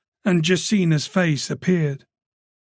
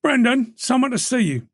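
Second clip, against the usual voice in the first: sergeant calling; 8 semitones higher than usual. A narrator's reading. Sergeant calls up to Moran.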